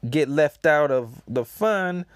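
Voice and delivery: Apu's voice. Acted. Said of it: "And he says".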